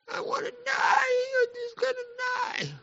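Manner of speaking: high, hammed-up crying wail; mock wailing. Preacher mocks Elijah wanting to die, fake sobbing. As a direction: wail it like a sulking child.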